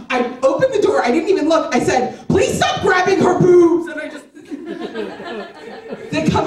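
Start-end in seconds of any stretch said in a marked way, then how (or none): 2.3-3.9 retold exasperated shout, high-pitched; fed up. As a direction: shout it like you've had enough. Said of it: "I was so fed up".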